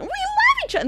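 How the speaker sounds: high squeaky mock gushing; mocking couple. Vlogger parodies showy couples, squeaky high gushing.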